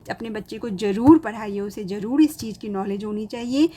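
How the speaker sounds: ordinary talk, nothing marked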